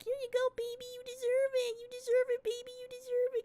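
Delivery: very high cooing voice; baby talk to pet. Retold or imitated. Owner gives her cat treats, cooing baby talk.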